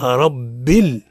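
slow "رب ال" with doubled b joined into the l; "رب ال" drawn out, ending on a held l; model pronunciation. Shown as a demonstration. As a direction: sound out "رب ال" slowly for students to repeat. Teacher models for class repetition.

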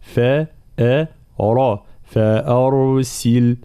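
فأرسل broken down slowly, thin فا and أ, dark را; careful tajweed breakdown of فأرسل; heavy ra, light hamza. Shown as a demonstration. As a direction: separate the sounds then recite فأرسل slowly. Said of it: "نفخمها مع ترقيق الفاء والهمزة".

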